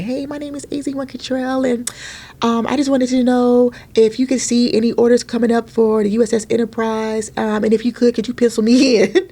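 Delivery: high, overly polite phone voice; sweet polite caller. Retold or imitated. To the detailer on the phone, imitating her sweet naive request. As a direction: sound eager and naive, asking a favor.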